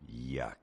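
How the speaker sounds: whispered low yuck; disgusted yuck. Retold or imitated. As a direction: spit it out in disgust. Mock disgust tag.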